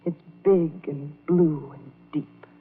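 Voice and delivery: slow, low, dreamy despair; dark brooding. Acted. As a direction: dwell slowly on each word, as if drawn to the lake. Brooding on drowning in lake.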